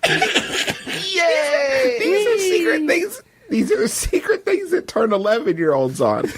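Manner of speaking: high voice cracking with laughter; laughing. Real, not acted. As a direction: talk through tears of laughter. Laughing through the line.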